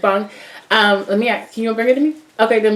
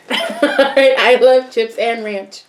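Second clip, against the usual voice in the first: laughing; 4 semitones higher, much slower than usual. Real, not acted. Excited chat about odd pizza topping.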